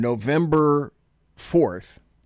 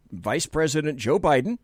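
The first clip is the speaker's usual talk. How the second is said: normal talking voice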